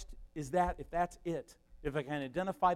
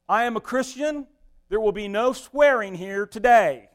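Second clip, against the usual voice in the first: loud, high, self-righteous announcement; pushy meddler; 7 semitones higher, 13 dB louder than usual. Acted. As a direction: proclaim it to the whole room, slowing down officiously. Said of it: "that's a meddler".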